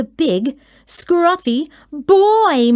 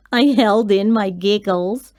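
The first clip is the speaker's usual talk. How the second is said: ordinary delivery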